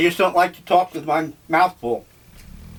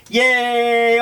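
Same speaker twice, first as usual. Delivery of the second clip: excited; 7 semitones higher, 3 dB louder than usual. Real, not acted. Cheerful about orange juice.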